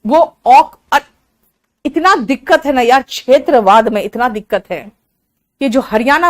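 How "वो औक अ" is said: ordinary delivery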